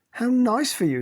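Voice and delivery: high, loud sarcastic sneer. A narrator's reading. Cynthia to Tiggy, sneering at her privilege. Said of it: "Cynthia sneered".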